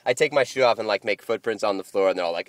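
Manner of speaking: ordinary talk, nothing marked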